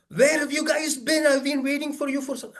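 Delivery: high, eager welcome call; Elon Musk greeting. Retold or imitated. Musk greeting late guests on Mars, high and eager. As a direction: call out like an impatient host.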